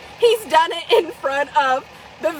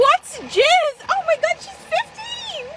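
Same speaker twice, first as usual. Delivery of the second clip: shocked disbelief; 11 semitones higher, slower than usual. Real, not acted. Echoing the teen's question in high shocked disbelief. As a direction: squeal it back in disbelief.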